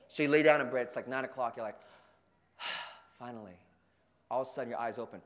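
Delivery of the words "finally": long unvoiced sigh, then a soft low finally; sinking into bed, a quiet relieved finally. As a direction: exhale all the stress. Collapsing into bed.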